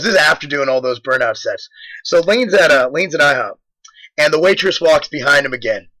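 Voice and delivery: ordinary talk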